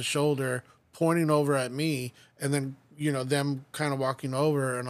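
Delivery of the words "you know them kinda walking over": ordinary talk, nothing marked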